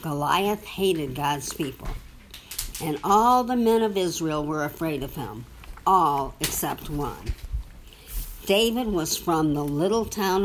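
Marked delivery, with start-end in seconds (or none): none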